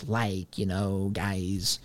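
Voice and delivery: lightly mimicked politician, low and soft; slow, filler-word verbal tic, quietly mocking; AOC's way of talking. Host mocking how the congresswoman talks, low mimicry. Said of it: "just the way that she talks".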